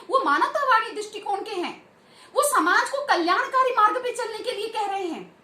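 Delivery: ordinary delivery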